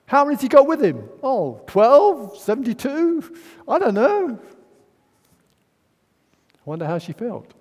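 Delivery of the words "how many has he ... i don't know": high, loud, fast flustered hostess; mock panic about feeding a crowd. Martha hearing Jesus is coming, high loud flustered panic. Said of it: "And Martha goes".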